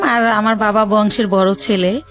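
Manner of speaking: normal talking voice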